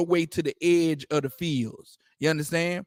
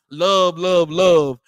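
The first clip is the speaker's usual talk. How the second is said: mildly raised mock cry; retold scream; 6 dB louder, much slower than usual. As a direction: call it out as an imitation, a bit louder. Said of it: "He the one screaming out".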